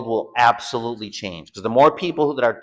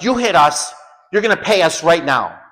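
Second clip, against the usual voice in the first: high-pitched, demanding mock yell; retold yelling; 5 semitones higher, 4 dB louder than usual. Corrupt cops yelling at us, retold angry demand. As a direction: imitate cops barking an accusation. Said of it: "They were yelling at us".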